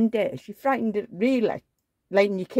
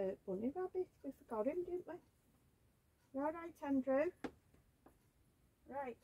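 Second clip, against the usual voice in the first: soft, higher-pitched cooing to little ones; baby talk to dolls; 6 semitones higher, 9 dB quieter than usual. Pretend. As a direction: coo gently, as if to babies. Cooing to her dolls off-mic.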